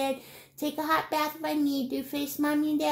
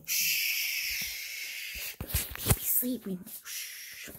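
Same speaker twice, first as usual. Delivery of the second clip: put-on slow whisper so the baby sleeps; hushed whisper; 6 semitones lower, 6 dB quieter, much slower than usual. Putting baby toy to bed.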